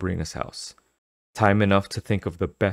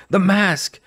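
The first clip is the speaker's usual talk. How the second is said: panicked cry; 13 semitones higher, 6 dB louder, slower than usual. Acted. Panic, mask stuck.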